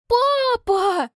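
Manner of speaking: high, loud protesting cry; outraged cry. A narrator's reading. Daughter protests.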